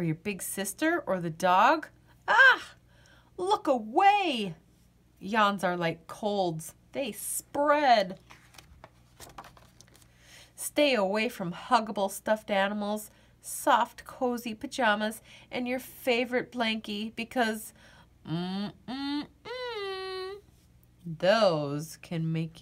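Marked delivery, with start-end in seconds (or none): 2.3-2.7 high loud yawn start; startled yawn gasp. Acted. Narrator catches a yawn mid-sentence, loud gasping yawn. 18.3-20.4 soft closed-mouth yawn rising to a whimper; muffled yawn hum. Acted. Reading to a child, stifling a yawn with a soft hum. Yawning while listing cozy things.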